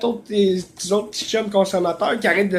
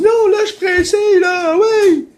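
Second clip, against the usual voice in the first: much higher, mock landlady voice; little woman's voice; 12 semitones higher, 6 dB louder than usual. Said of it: "Elle me dit". (imitation de la propriétaire avec une petite voix aiguë)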